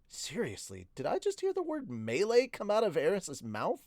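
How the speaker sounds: high-pitched disbelief; incredulous. A narrator's reading. Hero stunned by friend's vocabulary, incredulous. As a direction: question it as if you can't believe your ears. Narrator astonished by Eris's vocabulary.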